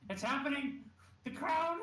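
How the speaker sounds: high, loud panicked cry; excited panic. Acted. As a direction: blurt it out in alarm. Panicked exclamation in character.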